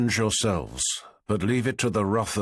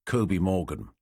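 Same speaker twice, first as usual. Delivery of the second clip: plain reading voice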